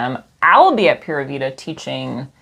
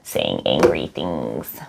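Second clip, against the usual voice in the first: deep slow voice; 13 semitones lower, 3 dB quieter, much slower than usual. Acted. Dark joke about drunk past.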